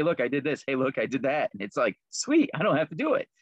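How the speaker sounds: ordinary delivery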